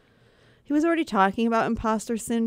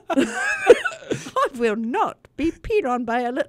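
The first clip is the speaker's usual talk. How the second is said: character voice; 6 semitones higher, 5 dB louder, slower than usual. Acted. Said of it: "I can't do that one".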